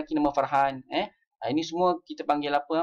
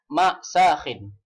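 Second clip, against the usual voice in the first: slow drilled phrase; 5 dB louder, much slower than usual. Shown as a demonstration. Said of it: "drilling the word".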